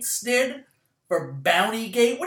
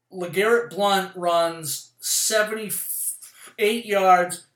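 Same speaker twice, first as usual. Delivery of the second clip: slow, weighty stat-by-stat forecast; deliberate pundit-style prediction; slow prediction call; 4 dB louder, much slower than usual. Real, not acted. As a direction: call each number out slowly like a pundit.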